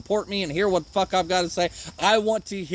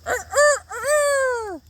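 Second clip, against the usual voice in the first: high-pitched mock rooster crow; much slower than usual. Shown as a demonstration. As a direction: crow like a rooster, last note held long. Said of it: "sound like a rooster".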